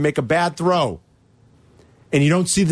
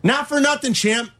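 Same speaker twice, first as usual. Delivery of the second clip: high-pitched, indignant scolding; upset; 6 semitones higher than usual. Real, not acted. Bettor scolding Baker over a sack, upset. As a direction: scold the player, pitched high with pauses between jabs. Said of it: "You sound upset".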